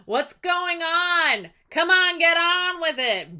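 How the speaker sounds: loud, high jeering heckle; hammed-up impatient crowd yelling; taunting suitors. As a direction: hurry him along mockingly. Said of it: "they're just taunting him the entire time".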